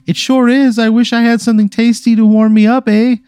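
very high, loud put-on lady voice; hammed-up falsetto commercial lady; pigtailed lady. Pretty lady answering the Mountie in their commercial, hammed-up falsetto. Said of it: "a pretty lady in pigtails".

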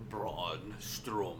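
slow, low mock Russian accent; a hammed-up Russian accent. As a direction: drawl the name in a put-on Russian accent. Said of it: "Why, why did we go to a Russian accent?".

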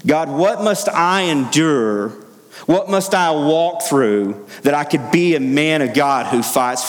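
slow, high pleading to God; fervent prayer. Acted.

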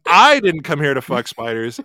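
"I" hit hardest at the start; stress on I. Shown as a demonstration. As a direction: punch "I" as if accusing someone else. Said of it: "he says the first one's like".

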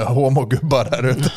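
fond laughter while telling of the hug; laughing through it. Real, not acted. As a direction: laugh out the words as he remembers the hug.

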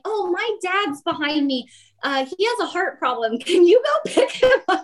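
high, pleading mock-innocent voice; feigned innocence. To gate staff, a high faked plea for dad. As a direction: act helpless and sweet, up high. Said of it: "I pretend not to know it".

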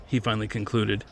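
usual speaking voice

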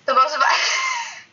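breaking into a scared giggle mid-word; nervous laughter. Real, not acted. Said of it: "I'm laughing 'cause I'm scared".

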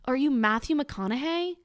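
ordinary delivery

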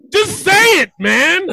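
exasperated yell. Real, not acted. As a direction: push him to spit it out, shouting. Frustrated at the slow answer.

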